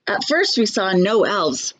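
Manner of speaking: plain reading voice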